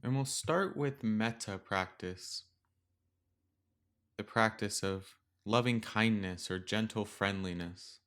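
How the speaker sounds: gentle metta meditation read; guided meditation. Acted. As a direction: ease the listener into stillness with long pauses.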